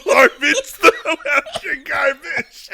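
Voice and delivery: high, loud catchphrase squeezed out through laughter; cracking up while quoting the mascot; laughing. Pretend.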